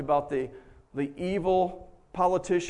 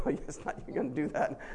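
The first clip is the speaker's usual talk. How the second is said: whispered, softer caution; retold whispered advice; hushed warning; 4 semitones lower, 9 dB quieter than usual. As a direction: lean in and whisper it. Quiet warning to friend.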